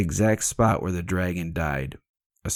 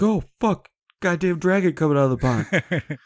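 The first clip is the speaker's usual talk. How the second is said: higher, louder, a startled laughing curse; mock startled cursing; 9 semitones higher, 4 dB louder than usual. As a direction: blurt it out like a knight who just saw a dragon. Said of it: "is like".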